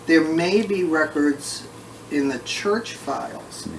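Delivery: normal talking voice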